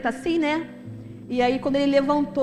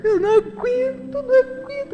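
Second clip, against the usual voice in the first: much higher, crying plea; sobbing; 9 semitones higher than usual. Retold or imitated. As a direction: voice cracking as she weeps. Friend sobbing.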